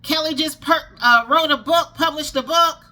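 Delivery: high, loud shouted announcement; street corner announcer. Retold or imitated. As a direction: call out to the whole street. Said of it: "Amazon is not standing on the corner saying".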